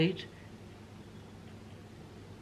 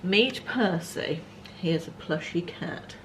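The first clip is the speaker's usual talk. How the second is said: ordinary delivery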